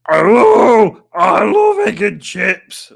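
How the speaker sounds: high, loud, slow whiny imitation; loud teary mock imitation; Alex's voice. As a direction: wail it like a mock crying fan. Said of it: "That's exactly what Alex sounds like".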